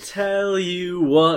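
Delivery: milk the setup very slowly; hammed-up catchphrase. Sitcom-style catchphrase bit.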